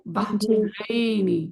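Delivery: بحرینی stretched very slowly with a long final ini; slow demo word. Shown as a demonstration. Teacher modelling the dual ending for students, very slow. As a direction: draw out بحرینی so the ending rings. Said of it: "یاد ہے؟".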